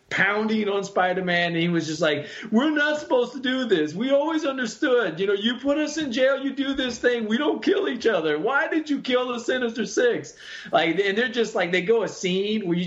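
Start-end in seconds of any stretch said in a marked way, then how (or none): 2.5-10.3 high-pitched mock distress, pleading; hammed-up distraught villain, voice raised high; distraught Sandman. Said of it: "the Sandman was distraught".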